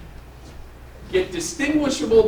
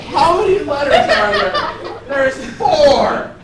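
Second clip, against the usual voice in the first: laughing; 8 semitones higher, 8 dB louder, slower than usual. Real, not acted. Exasperated, laughing re-explanation.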